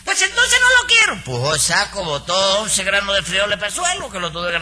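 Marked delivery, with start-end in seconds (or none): 0.0-1.1 hammed-up falsetto of a fussy customer; mocking Nananina's voice. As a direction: mimic the customer in a shrill falsetto. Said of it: "Entonces dice".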